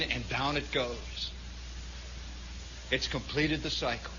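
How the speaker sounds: low, measured fable-telling; storyteller's hush. A narrator's reading. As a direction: draw listeners in quietly before the punchline. Hushed narration building suspense.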